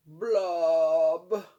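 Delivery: long loud blub to join in; booming demo blub; fish blub with child. Shown as a demonstration. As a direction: model the blub for the kids. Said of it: "Can you say it with me?".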